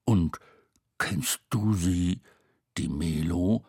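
careful low voice with pauses; cautious question. A narrator's reading. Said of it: "fragte Tete vorsichtig".

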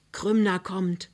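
usual speaking voice

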